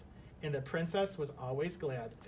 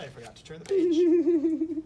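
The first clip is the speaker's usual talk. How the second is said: high, loud squeak of embarrassment; suddenly high and loud, caught out; squeaky embarrassed aside; 13 semitones higher, 10 dB louder than usual. Real, not acted. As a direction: pipe up high at your own slip. Reader notices mistake, squeaks.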